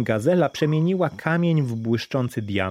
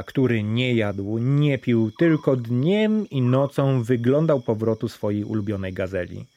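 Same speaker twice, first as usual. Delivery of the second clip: plain reading voice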